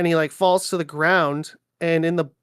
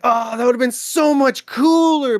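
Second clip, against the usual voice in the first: higher and louder gush; excited exclamation; 8 semitones higher, 7 dB louder than usual. Retold or imitated. Fan reacting to the book's gorier scene, excited.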